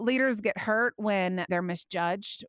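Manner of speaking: normal talking voice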